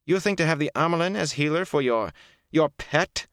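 high-pitched, scandalized disbelief; indignant exclamation. A narrator's reading. Liandrin challenges Moraine over the healer, exclaiming. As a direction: exclaim as if outraged. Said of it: "Liandrin exclaimed".

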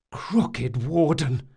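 slow, stunned gasp of an exclamation; shocked, drawn-out oath. A narrator's reading. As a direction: gasp it out, dragging the words. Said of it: "Locke gasped".

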